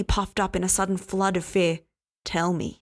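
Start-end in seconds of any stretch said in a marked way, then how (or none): none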